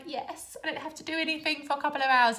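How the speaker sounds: high, fast happy relief; gleeful at a free afternoon; mild happy relief; delighted. Retold or imitated. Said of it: "I'm like".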